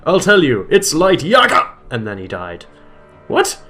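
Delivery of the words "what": ordinary talk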